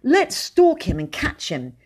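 eager, high-pitched scheming; rally a friend for an adventure; keen and excited, pitch jumping up. A narrator's reading. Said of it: "he said excitedly".

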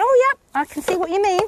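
lightly acted "oh, I see"; retold realisation. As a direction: quote her catching on. Retold instructor's dawning understanding.

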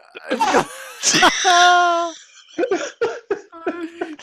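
a long high loud laugh close to tears; real crying laughter that you cannot stop; helpless laughter. Real, not acted. Host cracking up at a new chat message with long laughter.

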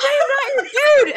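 high, loud, indignant squeal; mock indignant shriek. Wife shrieking at her husband on the phone, outraged. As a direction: screech it back at him. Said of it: "I said".

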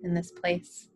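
calm, level-toned breath-by-breath guidance; guided meditation. Real, not acted.

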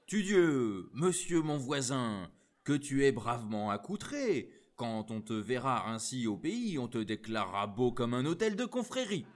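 loud, delighted southern friend; a hearty, booming welcome; boisterous greeting. Acted. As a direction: hail him with open arms. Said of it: "se jeta dans ses bras".

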